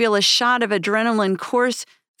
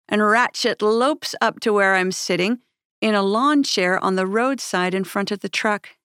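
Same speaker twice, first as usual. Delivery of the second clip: ordinary delivery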